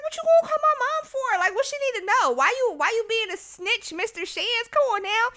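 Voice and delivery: high-pitched, indignant pleading; indignant protest. Retold or imitated. Student protesting to assistant principal about calling mom, high indignation. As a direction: argue back in a high, incredulous voice.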